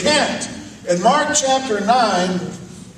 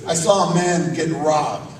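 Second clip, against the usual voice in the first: normal talking voice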